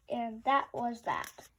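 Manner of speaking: usual speaking voice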